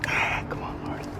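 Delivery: soft whispered prayer; hushed little plea. Real, not acted. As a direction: breathe it like a quick prayer. Quiet plea to God.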